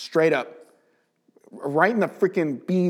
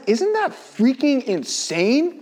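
high, slow, drawn-out marvel; amazed; 9 semitones higher, slower than usual. Real, not acted. Speaker marvelling to co-hosts, high slow amazement. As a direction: stretch it out in disbelief.

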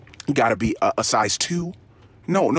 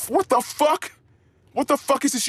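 exasperated; 13 semitones higher than usual. Acted. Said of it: "exasperated rant".

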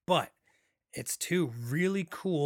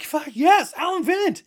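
much higher and louder, a thrilled yell; excited shout; 14 semitones higher, 11 dB louder than usual. Retold or imitated. Fan recognizing the actor on screen, thrilled loud yell. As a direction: shout it like you just spotted your favourite actor. Retelling spotting favourite actor onscreen.

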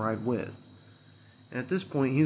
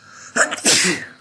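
another loud sneeze, breathy burst; sneezing; 7 semitones higher, 10 dB louder than usual. Real, not acted. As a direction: sneeze hard, all air. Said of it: "I sneeze".

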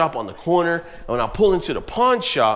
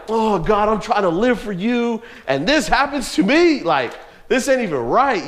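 higher, whiny mock lament, climbing as it goes; mock complaint to God; 6 semitones higher than usual. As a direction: play the hurt believer complaining to God, half in jest. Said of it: "I really could have been like".

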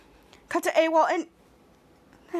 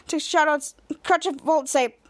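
ordinary delivery

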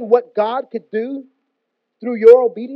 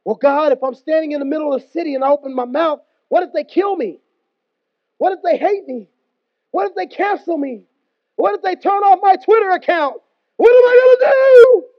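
starts as a worried question and ends much higher and louder; mounting mock panic; 5 semitones higher, 4 dB louder than usual. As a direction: let the fear climb to a frantic cry. Fearful excuses to God escalate.

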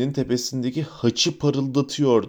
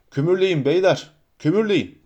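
narrated shout; 4 semitones higher than usual. A narrator's reading. Holmes calls down to the boiler room, shouting lightly. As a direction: call the order down, pitch lifted. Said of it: "Holmes kazan dairesine doğru eğilerek bağırdı".

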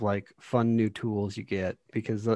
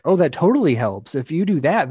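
higher and louder, quick eager burst; suddenly seeing the solution, a bit animated; eager realization; 5 semitones higher, 6 dB louder than usual. Retold or imitated. Co-op partner replying to a puzzle idea, excitedly.

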